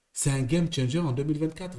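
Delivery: ordinary talk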